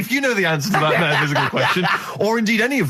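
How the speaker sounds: laughing through the plug; amused radio host voice. Real, not acted. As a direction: keep laughing as you read. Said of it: "laughing while reading out".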